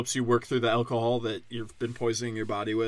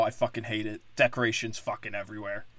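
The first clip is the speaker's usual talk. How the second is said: usual speaking voice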